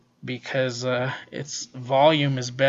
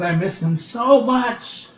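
higher, slower wistful line; wistful quote; 6 semitones higher, slower than usual. Retold or imitated. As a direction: sigh it out as a character missing someone. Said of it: "she says".

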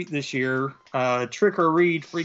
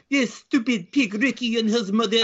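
mock French accent; 8 semitones higher, 3 dB louder than usual. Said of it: "She's like".

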